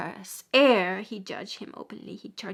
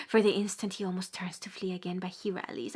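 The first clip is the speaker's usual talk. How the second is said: ordinary delivery, nothing marked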